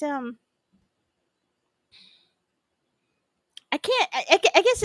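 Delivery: can't get the words out for laughing; real laughter. Real, not acted. Laughing at a viewer's teasing comment about behaving.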